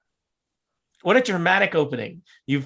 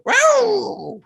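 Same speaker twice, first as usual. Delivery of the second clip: high wild gnoll howl, loud; hyena man; 6 dB louder than usual. Acted. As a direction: throw your head back and howl like a hyena. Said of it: "My gnoll voice".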